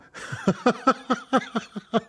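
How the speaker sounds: high, pulsing peal of laughter; performed staccato laughter; acted laugh. A narrator's reading. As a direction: break into a string of light high ha-has.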